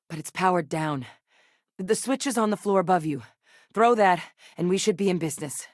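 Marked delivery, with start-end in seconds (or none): none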